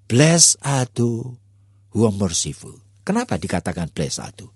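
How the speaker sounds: usual speaking voice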